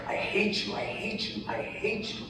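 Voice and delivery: high, hushed replay of a tantrum; muted mock tantrum, high and breaking into whisper; retold tantrum. Grieving widower tells God he hates him, hushed retold tantrum. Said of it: "tantruming, you know, tantruming, just saying".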